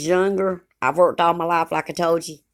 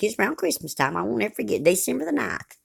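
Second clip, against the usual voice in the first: usual speaking voice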